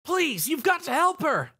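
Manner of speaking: high, desperate begging plea; pleading. Acted. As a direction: beg him, voice pitched high. Spike begs the rider.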